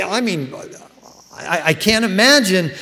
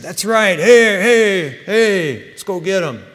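higher, louder hype shout; rowdy cheering; 3 semitones higher, 6 dB louder, slower than usual. Retold or imitated. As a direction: pump up the crew. Disciples cheer Peter on.

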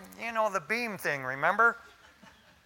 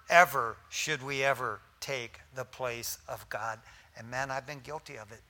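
ordinary talk, nothing marked

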